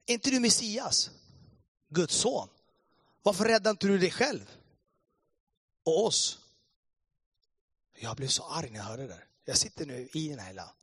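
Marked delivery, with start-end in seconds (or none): 0.1-6.4 higher, louder mocking jeer. Thief on the cross jeering at Jesus, mocking. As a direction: taunt him loudly and scornfully. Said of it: "började håna Jesus. Han sa".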